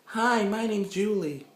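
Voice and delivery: put-on high, slow girly greeting; woman greeting a stranger in a club, high and slow. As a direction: play a girl saying hi in a club. Girl approaching in the club.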